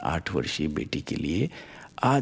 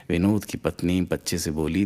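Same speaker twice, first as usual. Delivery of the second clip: ordinary delivery, nothing marked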